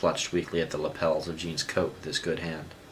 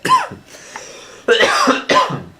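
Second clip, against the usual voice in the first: coughing fit; 12 dB louder than usual. Real, not acted.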